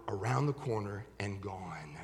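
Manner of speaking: hushed whisper, trailing off. Acted. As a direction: whisper it like a secret getaway. Mutt sneaking out quietly.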